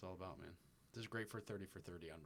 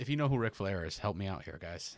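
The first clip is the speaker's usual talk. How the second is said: loud plea; 13 dB louder than usual. Real, not acted. Pleading with audience.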